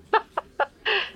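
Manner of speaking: embarrassed little laugh; sheepish laugh. Acted. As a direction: laugh at yourself, caught out. Realizes the answer.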